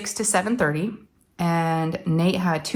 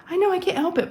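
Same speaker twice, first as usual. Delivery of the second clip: high-pitched teary plea; helpless, pitched way up; emotional whine; 13 semitones higher than usual. Retold or imitated. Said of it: "but I was so emotional".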